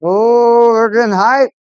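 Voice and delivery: loud, high, pumped-up cheer; hyped. Real, not acted. Streamer hyping the hockey game.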